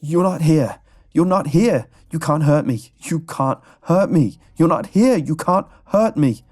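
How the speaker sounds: high, loud, terrified screams; screaming. A narrator's reading. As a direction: scream it over and over in agony. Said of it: "was screaming".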